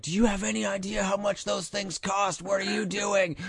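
high, mock angry mother scolding; mom yelling. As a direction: play the mom, shrill with exasperation. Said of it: "she yells".

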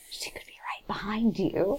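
breathy whispered warning, rising; spooky whisper. Acted. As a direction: whisper it like a ghost story.

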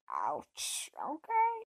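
mock-wounded, then squeakily conceding; comic retold reaction to a telling-off; stung acceptance. Retold reaction to being corrected.

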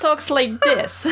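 high, slow New Jersey attempt sliding into Russian; botched New Jersey accent. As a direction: fake New Jersey that lands near Russian. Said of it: "I'm trying sounding like Russian".

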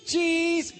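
excited. Acted. Punchline reveal of cheese.

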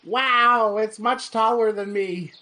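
put-on high, loud excited boy; excited little boy. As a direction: gasp it like an awed kid. Boy Rufus gets sword.

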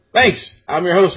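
ordinary talk

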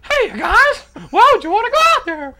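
like he just sucked a helium balloon. Pretend. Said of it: "sound like Jimmy Stewart on Helium".

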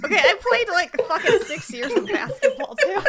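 high, laughing mock indignation; laughing protest. Real, not acted. Laughing self-defense after teasing.

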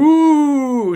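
high, loud mock ooh; surprised ooh. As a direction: squeal it out in surprise. High exclamation quoting character.

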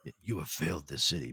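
hushed, whispered vigilante threat; Arrow catchphrase impression. Host echoing Arrow's catchphrase to co-host, whispered hero impression. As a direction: whisper it fast like a masked avenger.

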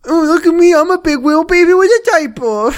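put-on loud, high whiny baby talk; mock him like a whining toddler. Mocking Ben as a big baby, loud high baby voice. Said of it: "a mocking voice".

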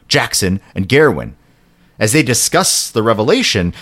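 ordinary talk

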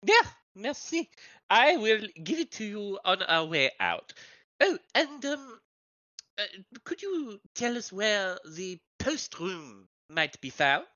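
affected, formal foreign character voice; visiting foreign journalist. Acted. Foreign journalist asking staff for the key, affected and polite. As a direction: put on courtly foreign politeness.

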